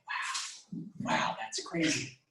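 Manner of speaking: breathed, drawn-out wow; hushed awe. Retold or imitated. Man recalling a ball of light in meditation, hushed awe. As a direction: whisper it slowly in amazement.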